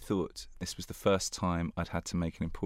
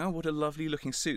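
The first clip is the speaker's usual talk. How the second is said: higher, quick mock compliment; gushing guest; 8 semitones higher, faster than usual. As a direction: coo over the suit. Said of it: "people going".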